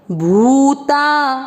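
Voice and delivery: भूता with the ऊ of भू held long and slow; long ऊ drawn out. Shown as a demonstration. Teacher showing students the long ऊ in भूता. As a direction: pull out the भू slowly. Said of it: "भ पर बड़े ऊ की मात्रा लंबा खींचेंगे".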